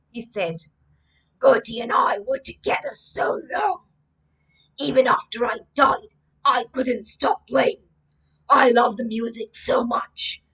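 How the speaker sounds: a gentle ghost introducing himself and his guitar; a dead guitarist telling his story; ghost musician. Acted. Voices the ghost Will.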